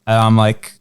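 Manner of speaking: ordinary talk, nothing marked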